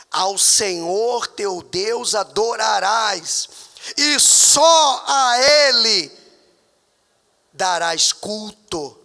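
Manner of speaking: slow, loud drawn-out proclamation; fervent slow preaching. Acted. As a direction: stretch each word and thunder. Preaching scripture slowly.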